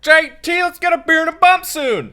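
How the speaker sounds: put-on very high, loud hollering old-timer. Co-host calling to host's dad, loud high holler. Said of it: "his voice is a, uh, memorable".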